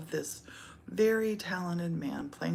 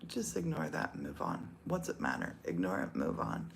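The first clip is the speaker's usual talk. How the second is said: calming self-talk; 12 semitones lower, 4 dB quieter than usual. Retold or imitated. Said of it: "I try to be like".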